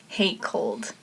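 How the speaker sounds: soft, understated grumble; subdued dislike. Real, not acted. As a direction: say "hate cold" softly, dropping away. First take, then repeated emphatically.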